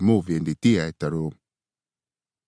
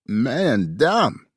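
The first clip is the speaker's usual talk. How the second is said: groaning; 7 semitones higher, 4 dB louder than usual. A narrator's reading. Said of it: "I groaned".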